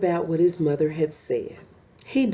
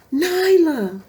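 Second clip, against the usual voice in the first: higher, louder relieved mother; relieved cry; 8 semitones higher, 5 dB louder than usual. A narrator's reading. Mother reunited with her cub, crying out higher and louder. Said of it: "her mother cried".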